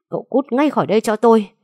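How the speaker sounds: fast, high, loud order to get out; furious shout. Acted. Enraged father orders the young man away, shouting. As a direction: throw him out with a yell. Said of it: "như phát điên".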